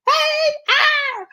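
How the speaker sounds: high, loud greeting turning into a shriek; mock scream. Imagining a scare at the car window, mock screaming. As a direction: shriek it like a startled bystander. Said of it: "there's screaming women".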